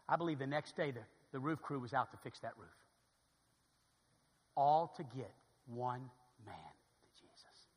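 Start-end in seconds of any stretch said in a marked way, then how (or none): 4.6-7.7 slow solemn emphasis. Real, not acted.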